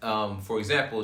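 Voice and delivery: normal talking voice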